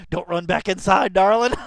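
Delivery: high, loud creepy croon; creepy imitation. Viewer imitating a creepy singer, loud high croon. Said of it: "That's Joseph Myrick right there".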